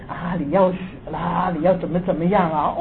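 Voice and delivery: low, soft menacing murmur; a hint of a ghost threatening in the ear; quietly voiced ghost whispering a threat; mild ghostly threat. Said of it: "恐吓我的话".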